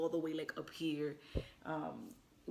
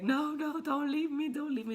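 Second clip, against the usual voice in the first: high, desperate plea not to be left; frightened plea, much higher and louder; pleading; 9 semitones higher, 5 dB louder than usual. Retold or imitated. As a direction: beg him to stay, voice pitched up in panic. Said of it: "my face was like".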